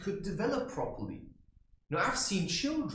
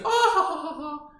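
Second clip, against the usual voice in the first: very high, loud mock laugh; imitated mother's laugh; 13 semitones higher, 9 dB louder than usual. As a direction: overdo a mother's delighted laugh. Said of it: "the mother is like".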